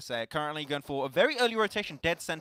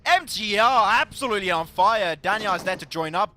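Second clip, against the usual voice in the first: excited play-by-play; 5 semitones higher, 10 dB louder than usual. Real, not acted. Casting the team's big fight.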